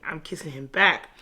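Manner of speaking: ordinary talk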